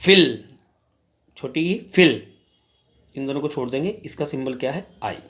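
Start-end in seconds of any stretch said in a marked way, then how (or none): 0.0-2.3 फिल with a short clipped i; teacher demonstrating a vowel; short i sound. Shown as a demonstration. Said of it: "छोटे छोटे दो mark".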